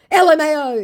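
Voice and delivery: very high, loud spelled-out scoff; mock scoffing scammer. Scammer laughing off the village insult, mock scoffing. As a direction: sound out the letters with mock derision.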